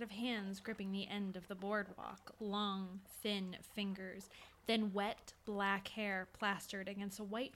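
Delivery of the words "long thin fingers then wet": ordinary delivery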